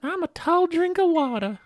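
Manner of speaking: high, loud mock boast; put-on Southern impression. Continuing the Natalie impression.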